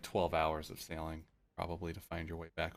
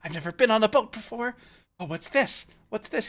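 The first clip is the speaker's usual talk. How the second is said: high, loud squeals of wonder; giddy, overexcited character voice; all excited; 13 dB louder than usual. Acted. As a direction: gush like a thrilled little creature seeing a boat. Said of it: "like, all excited".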